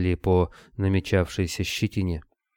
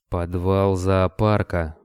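ordinary delivery

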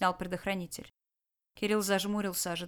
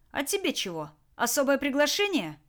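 higher, needling questions; mocking challenge; 6 semitones higher than usual. Jack to the last guard standing, mockingly impatient. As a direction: goad the one who is lagging. Captor goads guard who hasn't obeyed.

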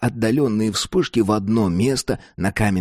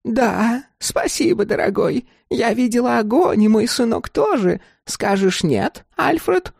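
high, honeyed crone voice; old woman playing sweet; 14 semitones higher than usual. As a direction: answer chattily, pitched well up.

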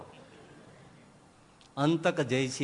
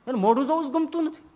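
higher, fast complaining plea to God; complaining prayer; 10 semitones higher, 4 dB louder, faster than usual. Retold or imitated. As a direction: rush out the grievance like a fed-up devotee. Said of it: "હે ઠાકોરજી".

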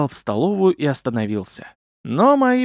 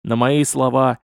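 ordinary delivery

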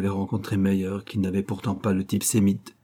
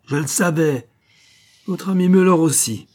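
high, loud, mounting accusation; triumphant accusation; 8 semitones higher, 6 dB louder than usual. Acted. Officer exposing Louis and his friend, accusing. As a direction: hurl it at him as proof.

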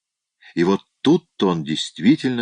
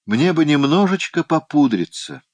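plaintive, higher-pitched wish; wistful; 8 semitones higher, 4 dB louder than usual. A narrator's reading. Emily longing to powder her nose, wistful. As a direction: voice a mild, high plea with longing. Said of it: "тоскливо сказала Эмили".

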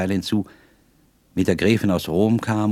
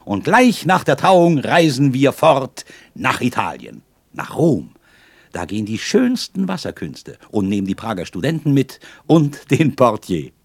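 higher, louder, eager rush of plans; joyful excitement; 6 semitones higher, 6 dB louder than usual. A narrator's reading. Young man joyfully plans the wedding trip to Italy with her. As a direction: spill the dreams out with delight. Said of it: "rief ich voller Freuden".

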